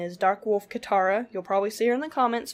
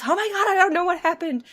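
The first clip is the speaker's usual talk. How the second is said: higher, panicky blurt; retold panic; 8 semitones higher, 4 dB louder than usual. Friend fresh out of the creek, panicking. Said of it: "she was in full-fledged panic mode".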